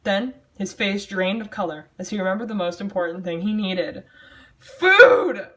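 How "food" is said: shouted high and loud; dramatic cry. Acted. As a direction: yell it like sudden doom. Dramatic climax of the story.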